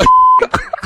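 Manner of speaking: husband laughing after the prank reveal; high relieved laugh. Real, not acted.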